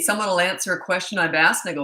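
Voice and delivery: ordinary talk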